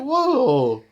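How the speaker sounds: high, loud amazed gasp of a word; big startled whoa. Retold or imitated. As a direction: blurt it out in awe. Retelling awe at heroes appearing.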